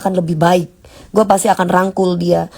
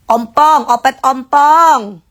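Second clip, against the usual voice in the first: ompong drawn out slowly and stressed; triumphant naming; 3 semitones higher, much slower than usual. Real, not acted. To the live chat, naming Opet with mocking triumph. Finally finds the word ompong.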